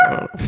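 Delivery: high rolled grr; [name] growl. Shown as a demonstration. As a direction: trill a playful grr. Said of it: "I can make the [name] sound".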